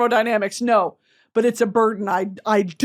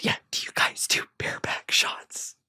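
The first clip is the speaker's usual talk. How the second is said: whispered, sheepish question; whispered order; 10 dB quieter than usual. Retold or imitated. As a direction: whisper it to the bartender. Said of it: "ordering a bareback shot".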